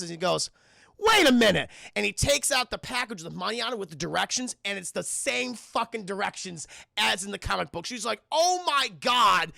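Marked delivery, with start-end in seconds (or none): 1.0-1.7 loud, high mock surprise; startled realization. Logan putting on glasses to read directions, loud startled realization. 8.3-9.5 loud, high, drawn-out disbelief; exasperated disbelief. Retold or imitated. Logan finding the comic's directions are the same, loud drawn-out disbelief.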